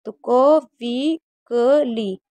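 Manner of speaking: क वी क ली split into slow separate syllables. Shown as a demonstration. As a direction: break क्विकली into क, वी, क, ली slowly. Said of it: "इसे पढ़ेंगे क्विकली".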